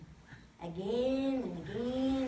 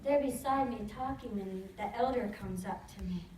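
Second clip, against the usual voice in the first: ordinary delivery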